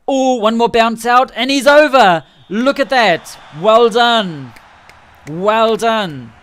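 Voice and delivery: loud, rising cheer as the try goes over; excited shout of well done. Real, not acted. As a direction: cheer him over the line.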